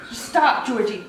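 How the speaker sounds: ordinary talk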